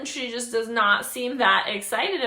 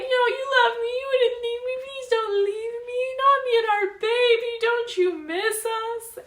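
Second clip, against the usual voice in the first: much higher, tearful whimpering plea; mock sobbing plea; 4 dB louder than usual. Agnes begging Zoe not to leave, hammed-up crying. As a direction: beg like a crying child not to be left. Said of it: "transitions like".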